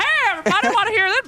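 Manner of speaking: high-pitched goofy hollering; banjo-playing bumpkin. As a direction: butt in like an eager banjo player. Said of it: "some guy with a banjo comes in, he's like".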